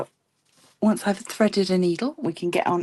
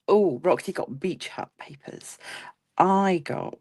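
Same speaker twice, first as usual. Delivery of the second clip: normal talking voice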